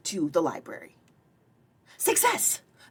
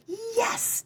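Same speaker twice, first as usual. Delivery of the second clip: eager yes. Acted. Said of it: "Daryl said".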